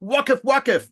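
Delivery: high-pitched mock shout; imitated yell back. Ethiopian soldier yelling back at the German, mock shout. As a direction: shout back the halt, playfully. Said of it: "the Ethiopian yells back at him".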